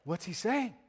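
lightly voiced interviewer. Interviewer asks the whale scientist, mock curious question. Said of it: "the interviewer is like".

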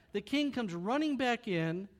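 ordinary talk

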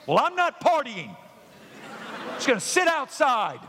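high, whiny sulking brother; sulky older brother. Acted. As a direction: pout like a spoiled older son.

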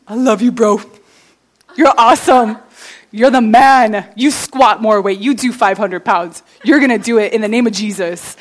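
loud bro-to-bro pep talk; emphatic, punched-out hype; hyped-up encouragement. Acted. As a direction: pump up your buddy like a gym partner. Acts out friend hyping up friend.

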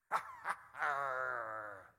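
a loud falling laugh at his own bit. Real, not acted. Preacher laughing with the congregation, loud laugh. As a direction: let out a long loud laugh. Laughs after pirate line.

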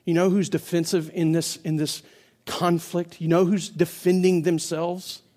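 ordinary talk

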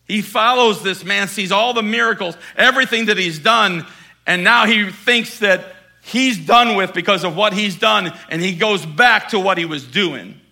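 loud, driving preacher delivery; preaching at full force. Acted. Preacher to congregation, loud full-force preaching. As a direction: push the point hard to the congregation.

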